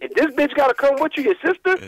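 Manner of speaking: high-pitched exasperated outburst; exasperated rant. Real, not acted. Caller venting to radio hosts about the trade, high exasperated outburst. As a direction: voice shooting up in disbelief.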